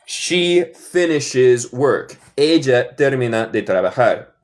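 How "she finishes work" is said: slow, drawn-out teacher demo; slow model sentence. Shown as a demonstration. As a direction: stretch each word for learners. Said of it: "teacher models slow sentence".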